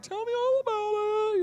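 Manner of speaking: very high falsetto mom; mom impression. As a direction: coo it as an exaggerated busy mother. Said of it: "that's how your mom sounds".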